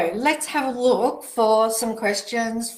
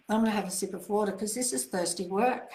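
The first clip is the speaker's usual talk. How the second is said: laughing aside; faster than usual. Real, not acted. Host laughing to viewers while taking a sip, fast and amused. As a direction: chuckle as you reach for water.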